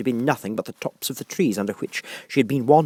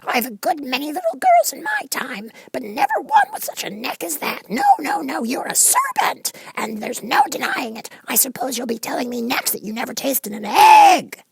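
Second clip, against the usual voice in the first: very high bird voice, scolding; a bird insisting she's a serpent; high scolding pigeon voice; accusing pigeon; 5 dB louder than usual. A narrator's reading.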